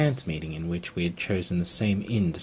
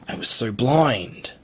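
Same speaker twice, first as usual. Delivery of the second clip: slow, raised, loud cry of regret; anguished self-reproach; 5 semitones higher, 6 dB louder, slower than usual. Acted. Prisoner cursing himself for falling for Abigail, anguished outburst. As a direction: swell with anguish at your own folly.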